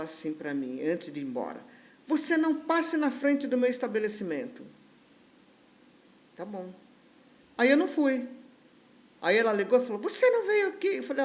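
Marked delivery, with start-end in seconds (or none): 2.1-4.6 angry warning. Retold or imitated. Client's parting warning, acted. 10.0-11.0 high, loud accusing question; raised-pitch, imitated telephone scolding; indignant demand. Client phones, demanding why absent.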